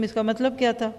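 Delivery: ordinary delivery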